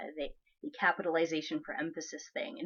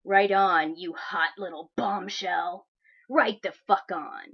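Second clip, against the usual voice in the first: mocking entitled guy; 6 dB louder, slower than usual. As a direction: ham up a pushy creep's message with heavy emphasis. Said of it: "And then he signs it with".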